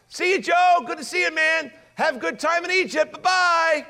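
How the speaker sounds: high, loud sing-out goodbye; mock cheery farewell. Brothers seeing Joseph off to Egypt, mock cheery shouting. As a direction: call goodbye gleefully after someone leaving.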